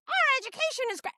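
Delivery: high, rushed mock-politician voice; mocking imitation. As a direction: parody her boast, fast and high. Mocks the governor's speech.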